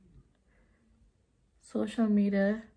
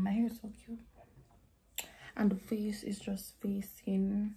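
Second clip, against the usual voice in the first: whisper; 7 dB quieter than usual. Real, not acted. To viewers about her own hair and face, a whisper. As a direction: murmur it admiringly to yourself. Whispered self-admiring aside.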